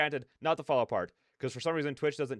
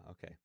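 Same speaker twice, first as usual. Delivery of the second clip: tired sigh; 8 semitones lower, 19 dB quieter than usual. Real, not acted. Quiet pause during stream.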